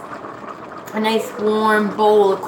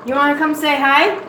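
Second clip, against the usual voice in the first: excited; 5 semitones higher than usual. Real, not acted. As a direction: call out to the viewers happily. Excited introducing girlfriend on stream.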